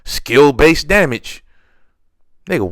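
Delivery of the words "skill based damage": high, loud, drawn-out mocking complaint; mock bitching. Imitating players complaining about skill-based damage, mock bitching. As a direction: whine it louder and higher, stretching the words. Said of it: "now they're bitching about".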